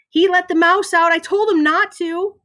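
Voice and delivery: put-on high, loud child telling tales; gleeful tattling. A narrator's reading. Frankie gets Ben in trouble with the teacher, gleeful. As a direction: tattle with relish. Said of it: "Frankie loves it".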